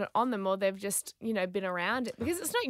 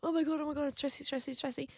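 hushed, higher-pitched mock panic; paranoid panic; 4 semitones higher, 9 dB quieter than usual. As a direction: fret under your breath, imitating nervous panic. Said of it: "I always get paranoid".

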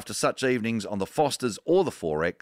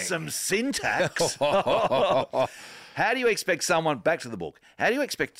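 slow, high, laughing indignation; laughing mock outrage; 9 semitones higher, slower than usual. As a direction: laugh through the complaint. Mock-pedantic reading with laugh.

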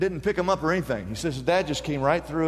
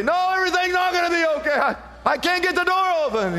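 put-on panicked child; 11 semitones higher, faster than usual. Panicked child voiced in story.

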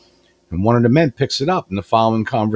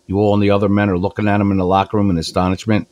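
normal talking voice

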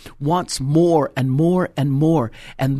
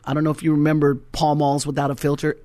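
normal talking voice

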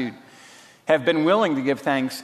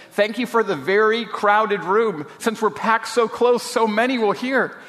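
eager thanks; 7 semitones higher than usual. A narrator's reading. Betsy acted, giving eager thanks.

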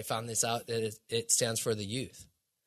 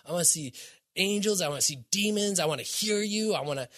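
calling out to God; 5 semitones higher than usual. Retold or imitated. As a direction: call out to the Lord, half-reliving it. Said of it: "I was just, like, calling out".

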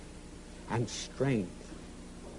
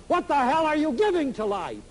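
retold shout; 9 semitones higher than usual. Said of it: "shouted out".

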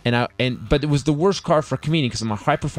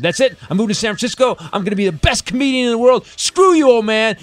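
high-pitched rant building to a shout; mock-furious young man storming out; defiant outburst; 10 semitones higher, 5 dB louder than usual. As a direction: announce your big exit, louder as you go. Acted defiant outburst at father.

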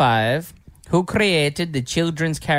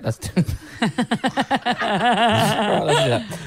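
laughing; 7 semitones higher, slower than usual. Real, not acted. Laughing through quiz restart.

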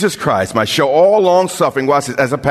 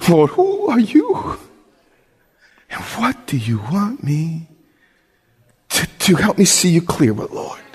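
earnest prayer voice rising to a cry. Acted. Pastor praying aloud for the viewers in an earnest prayer voice. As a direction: pray it from the heart.

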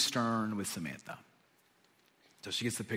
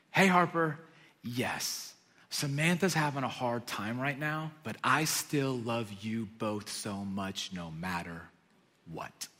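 tender reassurance; 3 semitones lower, 5 dB quieter, slower than usual. Retold or imitated. Father tenderly reassuring his daughter.

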